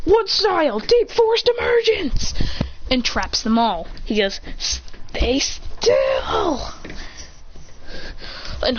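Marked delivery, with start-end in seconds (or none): 0.0-2.3 high, drawn-out anime attack cry; attack call. Acted. Character calls out an attack. 4.6-6.7 higher, strained mock cry; hammed-up effort to hold someone down; strained command. Character strains to hold enemies.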